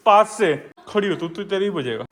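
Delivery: usual speaking voice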